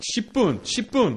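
imitating his own loud, high plea; high, loud burst of limited Korean; exasperated shout. Caretaker begging noisy kids in basic Korean, exasperated shout.

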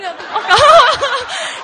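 a loud, long laugh. Real, not acted. Telling of crying with dad, a big laugh. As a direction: burst out laughing.

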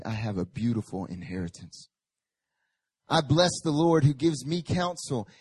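usual speaking voice

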